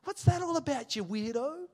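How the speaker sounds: put-on incredulous old self teasing his churchgoing mum; mocking sceptic. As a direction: scoff like the unbeliever he used to be. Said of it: "I'd be like".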